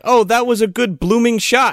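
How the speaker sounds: high-pitched fake British girl; mock British slang accent. Podcaster imitating the girl claiming to be Scottish, fake British accent. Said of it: "British, Irish, and Scottish vernacular".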